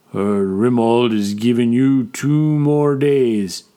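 slow, heavy brute's drawl; slowly. Acted. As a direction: take your time, weighty and slow. Orag voiced.

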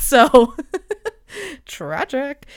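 laughing through the words; real laughter at her own bad luck. Real, not acted. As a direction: giggle at the self-mockery. Laughing at own love life.

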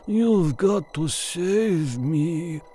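high, very slow, faint plea; a dying man begging for rescue; delirious pilot pleading. Acted. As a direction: beg weakly, dragging the words out. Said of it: "he was mumbling, delirious".

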